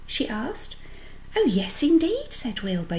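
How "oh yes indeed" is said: high, excited agreement; eager delight. A narrator's reading. Wilbur seeing his new friend, eagerly excited. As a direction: agree eagerly, pitched well up.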